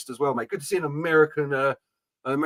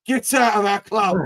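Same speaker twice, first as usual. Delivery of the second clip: loud, drawn-out EastEnders yell; higher, stretched-out mock EastEnders outburst; EastEnders accent; 4 semitones higher, 4 dB louder than usual. Fan's message to the club's owners, hammed-up EastEnders accent. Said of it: "in the best EastEnders accent you can do".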